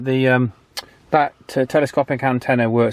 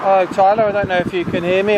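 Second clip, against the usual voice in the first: raised voice calling into the handheld radio; 6 semitones higher than usual. Real, not acted. Calling Tyler on the CB, raised voice. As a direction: call out to reach him on the radio.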